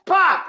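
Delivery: loud, high explosive pop; imitated balloon pop. Storyteller shooting a balloon, sharp loud popping noise. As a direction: burst it with your voice.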